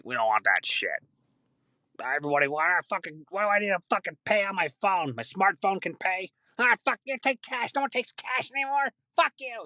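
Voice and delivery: put-on high, whiny cranky old-timer rant; mock an old man griping about phones; grumpy old person. Imitating grumpy old people.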